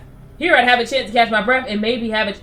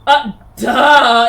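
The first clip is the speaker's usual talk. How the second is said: loud sarcastic duh; mocking duh; 2 semitones higher, 9 dB louder than usual. Real, not acted. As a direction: scoff it out loud. Mocking reply to game character.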